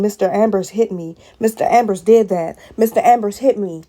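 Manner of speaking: loud, hammering each line with a fall; angry repetition. Real, not acted. Mother repeating her son's words in outrage, loud and hammering. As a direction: pound out each accusation in rage.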